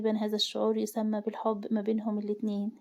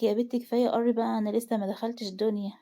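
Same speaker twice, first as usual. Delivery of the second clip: ordinary delivery, nothing marked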